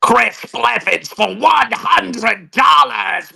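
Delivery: slow shouted possessed growl; Exorcist demon voice. Acted. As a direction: drag it out like a demon. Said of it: "reading out the fake super chats in the Ashton voice".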